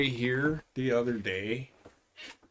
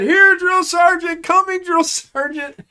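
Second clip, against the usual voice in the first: very high, loud eager shout; private shouting; 10 dB louder than usual. Retold or imitated. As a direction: yell it out like a nervous recruit. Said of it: "he said".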